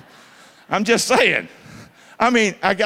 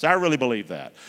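ordinary talk, nothing marked

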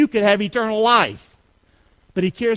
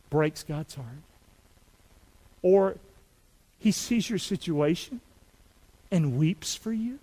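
soft, lower, with long pauses; gently reach out, letting each phrase hang; tender appeal; 5 semitones lower, 5 dB quieter than usual. Real, not acted. Preacher assures a hurting listener of God's care, tender and hushed.

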